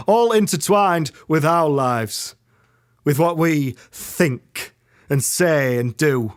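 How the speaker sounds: grave, building theatrical speech; dramatic monologue. Acted.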